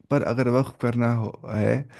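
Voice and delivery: ordinary delivery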